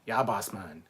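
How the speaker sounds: mocking Caribbean-style Belter accent, punched loud. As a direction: imitate a Jamaican-sounding Belter. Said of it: "It sounds like a Caribbean accent for all the belters".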